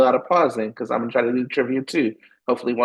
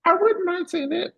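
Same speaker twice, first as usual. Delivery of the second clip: very high, playful giggly aside; teasing. Retold or imitated. Teasing fans about a dating rumor, high and playful. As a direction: tease the fans coyly. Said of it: "And now I'm like".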